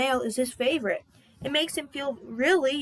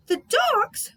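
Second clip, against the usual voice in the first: much higher, delighted cry; excited exclamation; 13 semitones higher, 4 dB louder than usual. Acted. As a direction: gasp it out with joy. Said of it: "Salty exclaimed".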